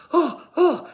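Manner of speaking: high, loud squeal of disgust; squeamish girls' groan. Retold or imitated. Junior high girls squirming at the spit, high squeal. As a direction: imitate grossed-out girls going oh, oh.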